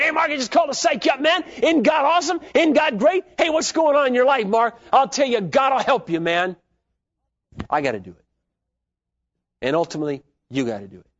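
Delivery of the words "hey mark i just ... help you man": hyped-up pal. Pretend. Imagined friend calling Mark to hype him up, hammed-up. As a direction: pump him up like an overeager friend. Mock pep call nobody makes.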